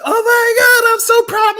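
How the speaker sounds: high, loud mock gush of a proud relative; proud family gushing. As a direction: squeal it like an overjoyed mom at the finish line. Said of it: "and they be like".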